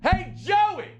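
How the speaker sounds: high-pitched yell across the field; coach's yell. Retold or imitated. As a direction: holler like a ball coach shouting to a runner, drawn out. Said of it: "Coach yells".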